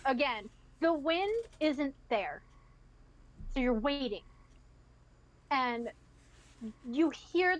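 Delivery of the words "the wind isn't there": ordinary delivery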